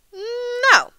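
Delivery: long, high, hummed-in no; mother's drawn-out no. A narrator's reading. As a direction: stretch out a high-pitched no. Mother answers pestering child.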